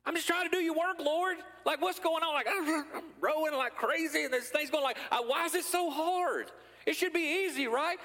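high, exasperated pleading to God; a believer complaining to the Lord, high-pitched exasperation; frustrated complaint. Retold or imitated. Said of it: "I get pretty mad at him".

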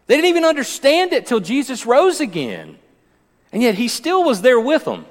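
high-pitched, emphatic preacher delivery; fired-up sermon voice; impassioned preaching. Real, not acted. Preaches with raised voice.

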